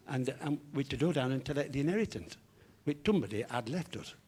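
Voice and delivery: comic speech defect, k and g turned to t and d; tongue-tied fronted consonants; Harry. Acted. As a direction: read it out with impeded, t-heavy diction. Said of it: "a slight speech defect".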